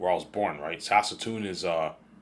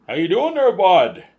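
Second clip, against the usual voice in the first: put-on loud, high-pitched Canadian accent; hardcore Canadian accent; 12 semitones higher, 12 dB louder, slower than usual. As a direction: shout it as a broad Saskatchewan Canadian. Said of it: "they have hardcore Canadian accent".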